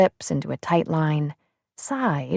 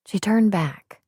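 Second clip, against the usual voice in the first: ordinary delivery, nothing marked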